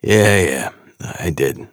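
hesitant gruff New York admission; a hint of a gruff New Yorker; gruff New York accent. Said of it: "gruff New York accent".

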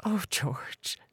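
tearful. A narrator's reading. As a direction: near tears, softly. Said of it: "impossible to hold back the tears".

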